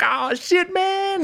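very high mock exclamation; imitated comedian reaction. Retelling a comic's reaction to the joke, high-pitched imitation. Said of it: "then he's, like, doing the".

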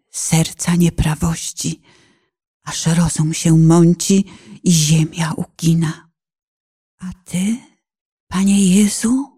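ordinary talk, nothing marked

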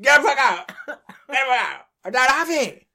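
high, loud mock shouted refusal. Imitating the DJ shouting him away. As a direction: yell it at him as a pretend DJ. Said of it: "and I said".

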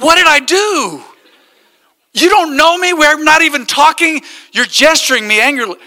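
hammed-up hurt protest at a rude driver; mock offended driver. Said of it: "I hate it when people are all like".

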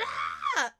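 short, loud mock scream; re-enacted shocked scream. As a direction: yelp as if horrified at the sight. Acting out shock at seeing blood.

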